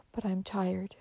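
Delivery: soft, tired near-whisper; weary. A narrator's reading. Dying girl to her nurse, weary. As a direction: let it out weakly, barely voiced.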